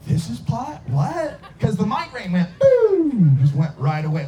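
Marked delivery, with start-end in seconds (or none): 0.1-1.4 high, rising disbelief; astonished wife. Retold or imitated. Voices wife's astonished reaction. 2.6-3.4 high, loud, drawn-out boom; whooshing boom. Retold or imitated. Imitates migraine shooting away.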